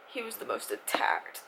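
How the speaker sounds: ordinary talk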